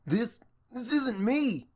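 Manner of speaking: high, slow, stammering panic; panicked. Acted. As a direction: struggle against the spell. Frozen bully panics.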